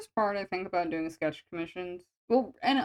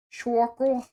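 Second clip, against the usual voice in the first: New York accent; 3 semitones higher, 4 dB louder than usual. Shown as a demonstration. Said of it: "do circle in New York".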